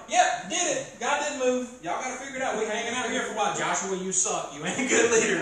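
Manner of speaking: high, loud, sassy priest brushing it off; mock-smug priest mouthing off at Joshua; cocky priest. Preacher plays a priest mocking Joshua, high and loud. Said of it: "if I'm a priest, like, I'm just probably".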